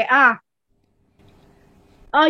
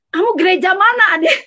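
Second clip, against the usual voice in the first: suddenly higher, louder, eager curiosity; surprised question; 7 semitones higher, 5 dB louder than usual. Retold or imitated. Friend on the phone suddenly asking her church, surprised. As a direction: ask it as if caught off guard and intrigued.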